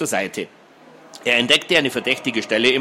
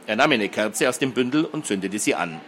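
ordinary delivery

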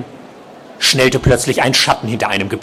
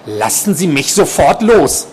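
higher-pitched, mildly alarmed order; firm demand; 6 semitones higher, 3 dB louder than usual. A narrator's reading. Nurse grabbed by a patient, firm raised demand to let go.

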